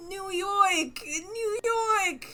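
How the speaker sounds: high-pitched, hammed-up New York accent; bad New York accent. Streamer playing up bad accents for chat, hammy New York. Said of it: "doing bad accents".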